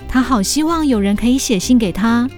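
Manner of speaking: ordinary talk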